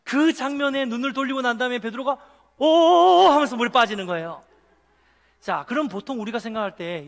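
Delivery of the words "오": long, loud, high "oh" cry; drowning cry. Acted. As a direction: cry out as if sinking. Acting Peter sinking in storm. (물에 빠지며 크게 외치는 "오")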